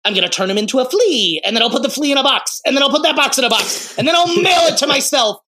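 hammed-up scheming villain; building villain plan. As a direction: rattle off the scheme with rising mania. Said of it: "first idea is, like".

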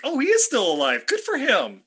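high, pleasantly surprised exclamation. Retold or imitated. Recalling seeing Josh Hartnett in a trailer, pleasantly surprised.

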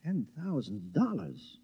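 hushed then rising in disbelief; shocked. Acted. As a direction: gasp at the sudden news. Husband shocked by withdrawal.

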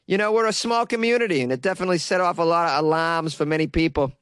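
put-on earnest small-town Bostonian, accent laid on. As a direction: quote read as a Boston local. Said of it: "to emphasize her Boston accent".

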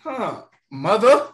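slow, high, startled; surprised Thor. Retold or imitated. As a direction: caught off guard by his mother. Thor voiced in a retold scene.

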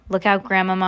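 ordinary delivery

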